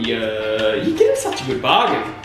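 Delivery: ordinary talk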